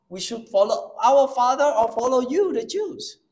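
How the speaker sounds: voicing the woman. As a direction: plead as the Samaritan woman. Samaritan woman voiced in sermon.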